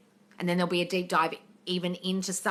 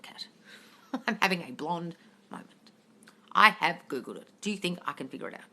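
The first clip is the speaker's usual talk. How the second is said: ordinary talk, nothing marked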